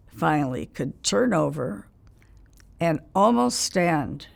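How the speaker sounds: ordinary talk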